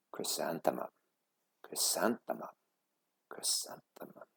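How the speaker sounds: dreamy whisper of her own name; fading to a whisper. A narrator's reading. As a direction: let the name melt away.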